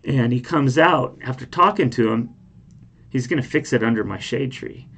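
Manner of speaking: ordinary delivery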